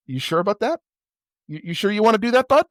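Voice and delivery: high, fast, sarcastic needling; most sarcastic. Retold or imitated. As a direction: tease him with heavy mock concern. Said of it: "in the most sarcastic way possible".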